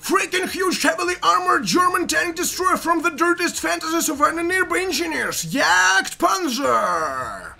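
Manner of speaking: high, loud ring announcer build-up; hyped shout. Acted. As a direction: build up and roar out the name. Hyped reveal of enemy tank.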